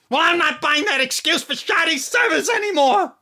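high, loud indignant outburst; angry rant. Acted. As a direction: vent the fury at the service. Ranting about unanswered prayers.

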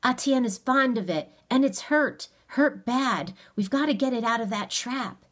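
heated, pressing insistence; angry urgency. A narrator's reading. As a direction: argue urgently, anger showing. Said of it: "awe vanished in anger".

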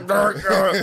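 high playful mock growl; growling noise. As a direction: growl it, half laughing. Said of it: "It's like".

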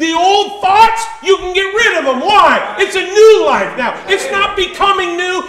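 loud, high, shouted sermon; impassioned pulpit shout; full-force preaching. Real, not acted. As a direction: belt it to the back pew, stretching key words.

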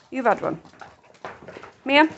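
ordinary talk, nothing marked

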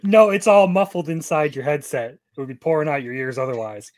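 ordinary talk, nothing marked

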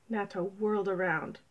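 ordinary delivery

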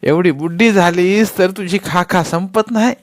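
angry scolding. A narrator's reading. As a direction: keep yelling at her. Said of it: "राधी एकदम संतापून ओरडली".

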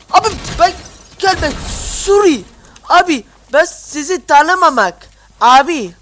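loud high broken-Turkish pleading; loud mock plea in pidgin Turkish; mock foreigner voice. Gamer voices broken-Turkish character.

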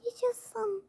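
soft whispered hooray; whispered cheer. Acted. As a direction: cheer under your breath. Quiet cheer before sleeping.

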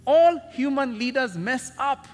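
full-force preaching. Acted. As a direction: hammer the point home to the congregation.

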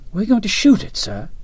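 put-on high-pitched, excited young ensign. A narrator's reading. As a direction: ask with boyish excitement, voice jumping up. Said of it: "Nichols asked excitedly".

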